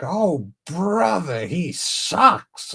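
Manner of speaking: high, drawn-out cartoon grumble; hammed-up high complaint; SpongeBob fish. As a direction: stretch it out like a fed-up cartoon fish. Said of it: "that one fish from SpongeBob".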